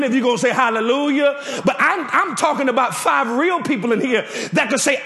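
ordinary talk

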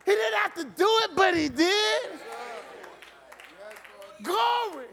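the preacher whips the congregation into a shouted, chanting peak; preaching at full force. Acted.

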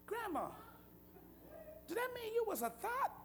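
soft, high-pitched daughter voice; child's innocent question. Retold or imitated. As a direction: ask it softly and high, like a young girl. Girl questions grandma at the table.

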